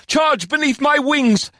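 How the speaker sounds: high, impassioned battle speech; rallying warrior leader. Acted. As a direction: cry out high to rouse an army.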